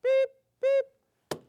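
two high, loud beeps; alarm beep imitation. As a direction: imitate a key alarm beeping. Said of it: "all I hear is beep".

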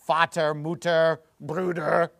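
Milch, Vater, Mutter, Bruder said in German; German pronunciation. Shown as a demonstration. Teacher shows how basic words sound German, German pronunciation. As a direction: read the words the German way, slowly. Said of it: "Those all sound like German".

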